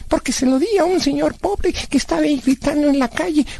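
put-on high-pitched kid pleased with his deed; high cartoonish self-justification; childlike Blas, self-justifying. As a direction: boast naively in a high character voice.

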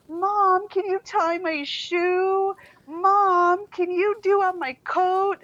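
put-on high nagging child calling mom; whiny child. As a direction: whine mom's name again and again. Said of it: "in that whiny voice".

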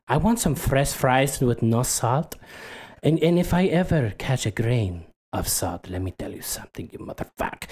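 put-on accented threat sinking to a hush; Pepe Le Fuck You. Rude customer warns drive-through about salt, hushed mock menace. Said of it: "he is very aggressive".

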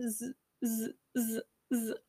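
soft buzzing zz noise, imitating the buzzers; buzzer imitation. Shown as a demonstration. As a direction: quietly buzz like a hand buzzer. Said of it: "it goes off one at a time".

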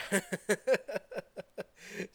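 high burst of disbelieving laughter; scoffing laugh of disbelief; incredulous laugh. Real, not acted. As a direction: laugh out at how absurd it is. Laughing at thought of Arthur Chew.

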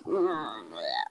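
low, soft, deflated groan; slump groan. Shown as a demonstration. Host demonstrating what a slump sounds like, deep deflated groan. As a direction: let the sound sink like a heavy sigh. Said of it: "the sound of a slump that I just made".

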